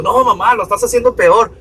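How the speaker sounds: high, pleading protest; flustered protest. Retold or imitated. Son pleading with his mother, high and flustered.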